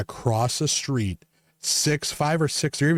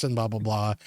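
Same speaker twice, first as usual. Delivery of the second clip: ordinary talk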